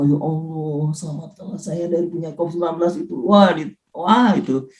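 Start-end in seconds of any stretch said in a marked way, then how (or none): 0.0-3.1 hushed, lower murmured prayer; solemn prayer. Acted. Patient praying to be saved from covid, solemn and hushed. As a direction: pray softly and earnestly to God. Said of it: "doa kita juga khusyuk itu".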